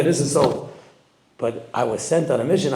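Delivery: ordinary delivery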